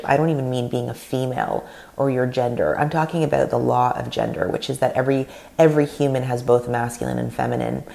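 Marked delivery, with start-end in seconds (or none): none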